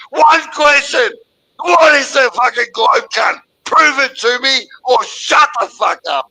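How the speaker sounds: loud, high furious demand; angry shout. Real, not acted. Caller demanding Gordon prove the globe, angry shout. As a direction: bark the demand at him.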